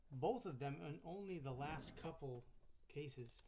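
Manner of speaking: ordinary talk, nothing marked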